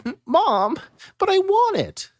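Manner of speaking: put-on high begging kid voice; a little boy pleading for a toy; whiny child. Child whining to his mother for the toy.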